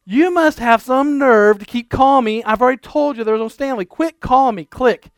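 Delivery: mock telling off a prank caller; hammed-up angry phone rant; angry stranger. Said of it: "that's anger".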